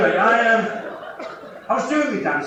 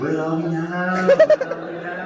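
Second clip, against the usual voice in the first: lost illusion. Retold or imitated. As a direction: wail it like a lost little voice. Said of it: "the illusion tries to go".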